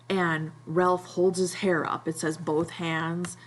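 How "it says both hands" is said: ordinary delivery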